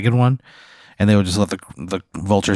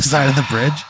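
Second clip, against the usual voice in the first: higher, amused through laughter; laughing narration; 4 semitones higher than usual. Real, not acted. Game narrator telling friend he fell to his death, laughing. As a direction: break up while delivering the bad news.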